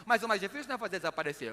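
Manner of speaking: ordinary delivery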